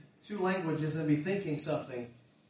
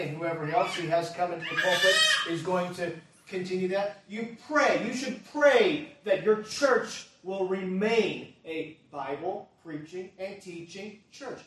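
loud, emphatic sermon delivery; raised preacher's voice with weighty stresses; forceful preaching; 4 semitones higher, 8 dB louder than usual. Real, not acted. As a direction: drive each point home to the congregation. Urges congregation to pray, loudly.

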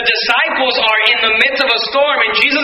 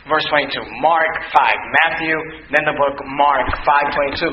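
ordinary talk, nothing marked

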